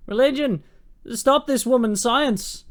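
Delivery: high, loud and slow, a hammed-up zealot; mock church decree. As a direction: boom it out like a pompous preacher condemning her. Voicing churchman ordering her burned.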